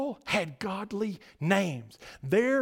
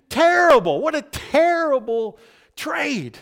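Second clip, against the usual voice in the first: exclaiming; 8 semitones higher, 7 dB louder than usual. Real, not acted. Mock outrage at bad trade.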